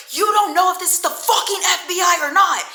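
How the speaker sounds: high, loud, exasperated comeback; mock angry retort. Man defends hanging up on the agent, mock angry. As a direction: imitate him yelling back in outrage.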